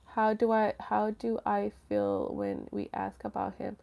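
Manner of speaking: very soft, reading to yourself; murmured reading. Real, not acted. Streamer reads a viewer's question, murmured to herself. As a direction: read the question under your breath.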